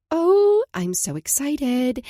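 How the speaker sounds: high, gushing and slow; excited. Acted. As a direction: gush with delight.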